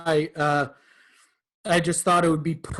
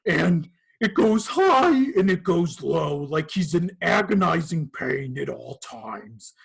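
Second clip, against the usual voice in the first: mutated gator voice. Shown as a demonstration. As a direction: sound like you're in constant pain. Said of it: "he kinda has a voice like this".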